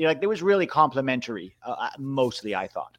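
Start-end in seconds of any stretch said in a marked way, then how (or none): none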